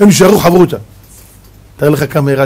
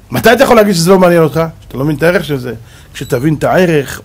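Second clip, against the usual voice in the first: normal talking voice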